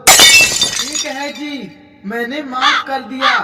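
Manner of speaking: grudging. Acted. Wife grudgingly forgives mother-in-law.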